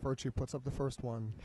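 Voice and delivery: hushed golf commentary; soft, quiet call of the shot; golf commentator. Real, not acted. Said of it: "Almost gotta talk like a golf, a golf commentator".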